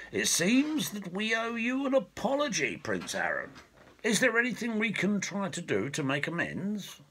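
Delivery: lightly voiced king, now courteous; gracious king. Elf king apologising to his former prisoner, lightly courteous. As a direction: offer amends like a gracious host.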